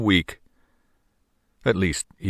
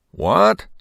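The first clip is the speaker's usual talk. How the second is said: sharp, high astonished "What?"; a startled, disbelieving cry; surprised selectman; 13 semitones higher, 5 dB louder than usual. Acted. Selectman hears impossible promise.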